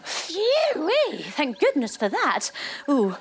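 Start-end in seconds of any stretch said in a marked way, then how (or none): 0.0-1.2 high, sighing phew-wee; relieved phew. Acted. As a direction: blow out the relief. Relief after the zip works.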